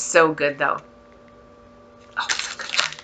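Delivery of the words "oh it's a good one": soft whispered approval. Real, not acted. Sniffing the wax, whispering approval to herself. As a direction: whisper it to yourself.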